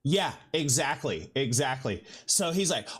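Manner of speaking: normal talking voice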